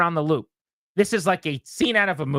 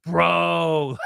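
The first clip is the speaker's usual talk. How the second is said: dismayed bro. Retold or imitated. Owner's dismayed reaction.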